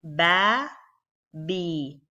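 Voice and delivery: "ba" open then "B" as bi closed; demonstrating fatha and kasra on ba. Shown as a demonstration. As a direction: contrast "ba" and "B". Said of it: "reading it with the letter ba".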